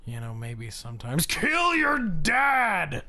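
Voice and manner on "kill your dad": sudden shout. Pretend. Sudden punchline after whispering.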